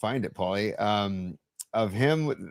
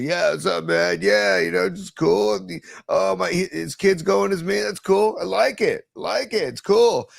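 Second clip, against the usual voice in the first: higher, louder laid-back stoner impression; 7 semitones higher, 4 dB louder than usual. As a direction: play it loose and easygoing, dude. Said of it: "he just sounds like a stoner".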